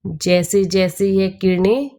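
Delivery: unhurried meditation voice with long pauses; guided meditation. Acted. Teacher leading listeners into soul-meditation with Shiv Baba, calm guided meditation. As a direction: guide them inward phrase by phrase, letting each settle.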